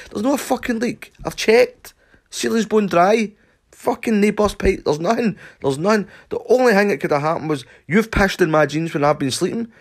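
high, loud ranting accusation; furious accusation. Retold or imitated. Shouting at Stumpy about the puddle on the carpet, high and loud. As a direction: rant at him, insisting. Arguing with friend.

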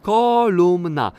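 "Kolumna" said slowly and loudly, each syllable clear; careful repetition. Shown as a demonstration. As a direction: repeat "Kolumna" deliberately, as if learning the word. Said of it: "Powtórz, Felku". (wyraźnie i powoli powtórzone „Kolumna”)

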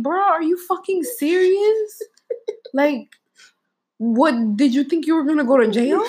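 high-pitched, incredulous retold outburst; exasperated disbelief. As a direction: stretch it out as if appalled at an overreaction. Retold outraged disbelief at boyfriend.

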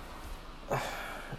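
long tired sigh; heavy sigh. Real, not acted. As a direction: sigh it out, weary and let down. More injury news.

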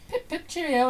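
high mock-British catchphrase; British cartoon character. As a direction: imitate a cartoon Brit saying goodbye. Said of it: "they had a British person".

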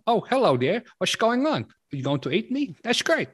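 high, loud cheery sailor; Dutch sailor voice. Retold or imitated. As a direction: chirp it like a naive sailor. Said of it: "A Dutch sailor".

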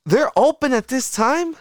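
high, loud disbelieving squeal; surprised. Real, not acted. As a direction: shriek in shocked surprise. Surprised at late-night food.

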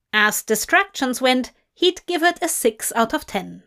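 ordinary delivery

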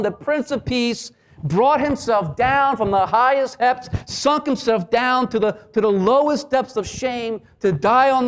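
fiery gospel proclamation; full-force preaching. Acted. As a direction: preach it out to the whole room.

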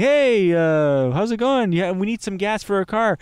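high, bright, mock-casual greeting; imitating a friendly stranger. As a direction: play the cheery guy at the door. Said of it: "being like".

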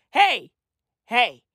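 ordinary talk

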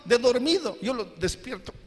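normal talking voice

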